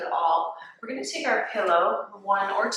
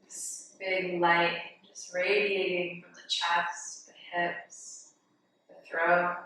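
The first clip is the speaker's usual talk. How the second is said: guided meditation. Real, not acted. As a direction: guide them gently into the breath, softening on the counts. Leads breathing meditation.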